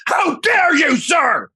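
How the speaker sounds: over-the-top fake indignation; mock outraged shout. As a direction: shriek it like a wounded aristocrat.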